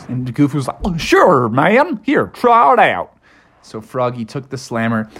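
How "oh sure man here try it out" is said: higher, swooping goofy voice; Goofy character voice. A narrator's reading. Goof lets Froggy try the slammer, high goofy voice. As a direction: play an agreeable goof, pitched up.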